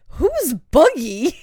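high, loud and slow, breaking into a laugh; puzzled, then cracking up at the name; confused who. Retold or imitated. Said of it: "I was like".

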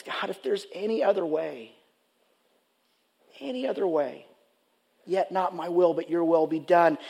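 Jesus praying in the garden, softly pleading; anguished prayer. Retold or imitated. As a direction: plead quietly, pressing on "other". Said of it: "he cries out authentically".

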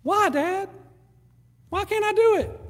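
put-on high child's voice asking why; whining child; whiny kid voice. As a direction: play the kid pushing back. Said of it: "growing up, child asking".

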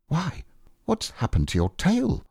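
high-pitched startled question; surprised. A narrator's reading. Pooh notices his friend's tail is gone, high and surprised. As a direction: jump up in pitch, taken aback. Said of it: "he said in surprise".

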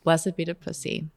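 hushed liturgical chant; mock prayer. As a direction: murmur it like a prayer.

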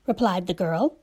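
usual speaking voice

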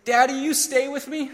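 high-pitched imitation of a small child asking; little boy. Grown-ups like a boy asking Daddy, imitated high child plea. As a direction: plead lightly in a young son's high voice. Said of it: "like that little boy who says".

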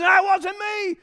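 high, loud protesting shout; defensive protest. Retold or imitated. Husband denying blame to his wife, loud defensive shout. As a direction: insist it wasn't you.

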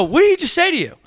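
retelling the fight with the Uber driver, angry yelling; angry retold yelling. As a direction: yell at the driver in disbelief. Retold heated argument with driver.

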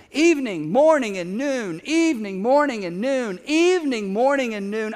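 a rhythmic, insistent chant of "Evening, morning, and noon"; emphatic repetition. Acted. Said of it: "this is so powerful".

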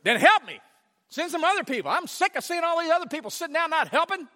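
high, loud, fed-up demands; frustrated demand. Retold or imitated. A tired servant demanding God send help. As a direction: insist someone else do their share.

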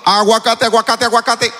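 a light imitation of a street crier selling avocados; sing-song the word aguacate like a hawker; mild mock vendor cry; avocado vendor call. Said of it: "O está uno tranquilo".

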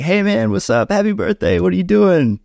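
high, bright-pitched upbeat greeting, well above his own voice; cheery friend on phone. Retold or imitated. Friend calling on his birthday, upbeat and high-pitched. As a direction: play the chipper buddy calling to say happy birthday.

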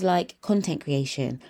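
normal talking voice

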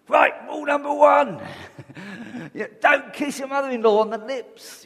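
loud, high, hammed-up rule-giver voice; mock strict rulebook. Preacher mocks a legalistic rulebook with a loud hammed-up voice. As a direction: bark out the rules like a fussy legalist.

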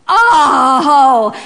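long, loud groan of amazement; drawn-out astonished cry. Real, not acted. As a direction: let out a big, loud "ohhh". Big groan at Paul's return.

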